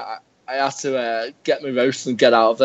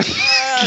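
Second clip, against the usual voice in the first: high, drawn-out "aah" scream; mock scream; 11 semitones higher than usual. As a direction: imitate a long shriek of shock.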